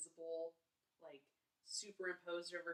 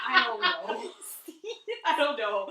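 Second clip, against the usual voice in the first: loud, laughing too hard to talk; words bursting out through real laughter; helpless laughter; 3 semitones higher, 14 dB louder than usual. Real, not acted. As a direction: crack up while admitting defeat. Cracking up over garbled title.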